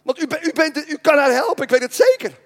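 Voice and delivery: a desperate mother's high cry for help; pleading mother. Acted. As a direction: beg on your knees for your daughter. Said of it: "viel die mevrouw op de knieën en zei".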